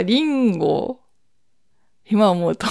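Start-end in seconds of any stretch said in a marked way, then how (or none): 0.0-1.0 リンゴ slowly, in Akita pitch accent; Akita intonation. Shown as a demonstration. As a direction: give リンゴ a drawn-out Akita dialect melody. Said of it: "秋田弁はリンゴ". (秋田弁のイントネーションで「リンゴ」)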